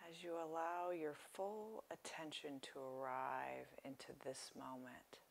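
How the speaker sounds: softly paced phrases, leaving space to breathe; guided meditation. Real, not acted. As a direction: guide listeners gently into relaxation, unhurried.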